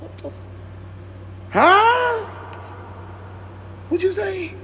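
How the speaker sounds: can't believe the dog talked; mock shock at a talking dog; shocked disbelief.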